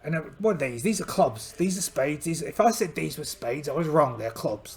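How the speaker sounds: ordinary talk, nothing marked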